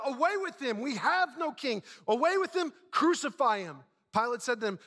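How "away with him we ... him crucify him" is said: high, insistent crowd cries; acted high-pitched outcry; crying out. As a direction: cry out like a mob rejecting him. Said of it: "they cried out".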